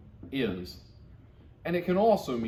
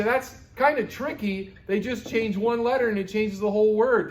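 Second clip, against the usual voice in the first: student's complaint; 10 semitones higher, 5 dB louder than usual. Acted. Student complaining about Latin, whiny and higher. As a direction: act out a frustrated student. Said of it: "You say".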